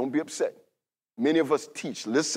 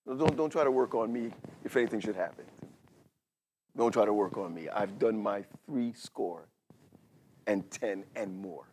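low, soft, slowing to a halting close; solemn retold plea; 6 semitones lower, 6 dB quieter than usual. Preacher recalling his dad's wishes, solemn and subdued. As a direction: quote it gravely, letting pauses grow. Quoting ailing father's last wishes.

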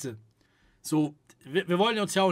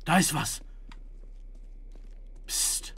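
soft hushing, much softer; hushing the chat; 5 semitones lower, 12 dB quieter than usual. Real, not acted. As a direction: keep quiet, listen. (leise, zum Schweigen auffordernd)

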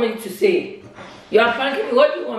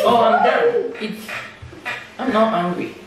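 laughing. Real, not acted. Laughing off prank.

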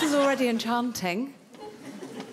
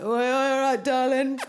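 van lad catcall; 3 semitones higher, 4 dB louder than usual. Retold or imitated. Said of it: "I can't do it how they do it".

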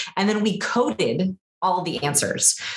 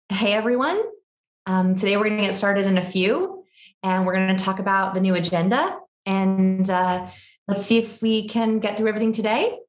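every phrase climbs into an unsure question; question inflection; 2 semitones higher than usual. Shown as a demonstration. Nervous host opening a Zoom call, question inflection on every phrase. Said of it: "so many Zoom calls start like this".

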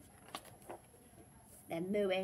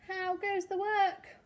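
high, loud farmer's call; 9 semitones higher, 5 dB louder than usual. A narrator's reading. Farmer calling to the duck, high loud call.

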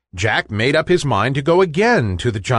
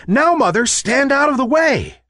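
urgent warning; 11 semitones higher than usual. A narrator's reading. Jack warns mother, axe swinging.

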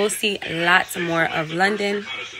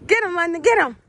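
high, eager cheering on; excited urging; 10 semitones higher, 5 dB louder than usual. Real, not acted. Urging the dog to run and chase, high excited calls. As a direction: egg the dog on in a raised voice.

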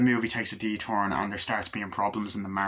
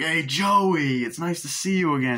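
higher, louder acted welcome; big mock-friendly hello; effusive greeting; 6 semitones higher, 5 dB louder than usual. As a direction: greet a long-lost man heartily. Retold greeting on arrival.

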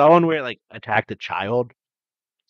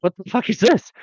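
higher, startled mock outburst; 9 semitones higher than usual. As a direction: blurt it out as if a kangaroo just hopped at you. Explorers startled by a kangaroo.

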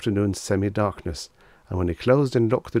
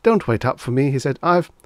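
high, loud, edgy brush-off; jittery and eager to get away; nervous smile; 7 semitones higher, 6 dB louder than usual. A narrator's reading. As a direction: toss it off nervously, voice raised. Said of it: "smiling nervously".

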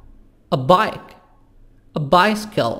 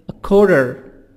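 American "quarter" with tapped T and sounded Rs; American accent. Shown as a demonstration. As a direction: say "kworder" the American way.

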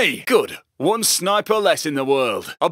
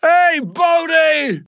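calling out; 9 semitones higher, 4 dB louder, much slower than usual. Acted. Heister shouting to a teammate.

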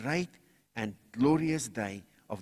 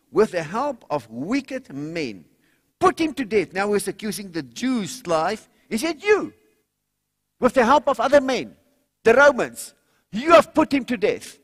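loud, high rallying preacher cry; preaching at full force; 8 semitones higher, 7 dB louder than usual. Acted. Preacher to congregation about being saved, loud rousing preaching. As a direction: rouse the congregation to call on the Lord. Preacher voicing Peter's accusation.

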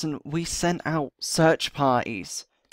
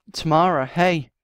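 calling out; 2 semitones higher, 7 dB louder than usual. Acted. Calling to someone on ledge.

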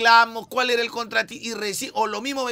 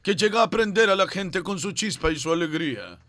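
announcer voice; slower than usual. Acted. Dramatic superhero intro announcement.

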